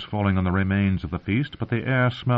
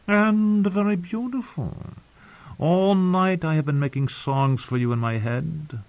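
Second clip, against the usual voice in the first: wooing singer; 7 semitones higher than usual. A narrator's reading. Drunken singer flattering the tired girl, high languid wooing. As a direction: draw out the compliments in a lilting high voice.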